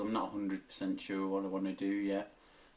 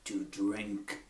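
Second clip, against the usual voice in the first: movie trailer voice. Retold or imitated. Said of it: "do some impressions".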